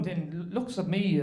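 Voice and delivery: usual speaking voice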